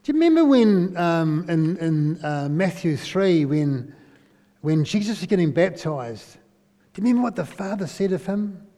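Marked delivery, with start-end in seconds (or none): none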